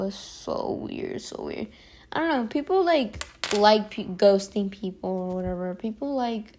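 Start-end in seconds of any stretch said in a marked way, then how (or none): 0.0-1.7 whispered, slowing, almost to herself; whispered aside. Real, not acted. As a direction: drop to a whisper as if muttering privately. Trailing off to herself.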